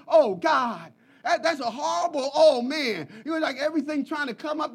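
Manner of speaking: usual speaking voice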